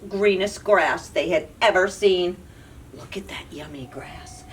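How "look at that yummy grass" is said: whisper. Acted. Goat whispers about grass.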